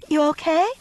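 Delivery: high, loud breathless check; out of breath from running, asking neighbors. A narrator's reading. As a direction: gasp it out after running. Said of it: "I was out of breath".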